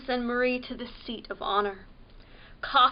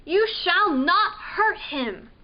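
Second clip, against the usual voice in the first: defiant; 6 semitones higher, 5 dB louder than usual. Acted. Marie defies Mouse King.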